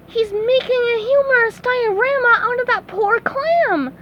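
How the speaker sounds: gasp, then high-pitched dismay; high cartoon horror; horrified gasp. A narrator's reading. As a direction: suck in breath and cry out aghast. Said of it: "SpongeBob gasped".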